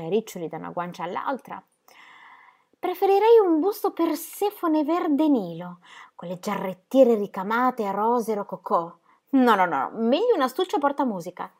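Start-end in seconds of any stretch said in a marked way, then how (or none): 2.8-11.5 put-on a young girl savouring a wish; Gigi's girlish voice. A narrator's reading. Gigi tells her uncle what gift she wants, high and dreamy.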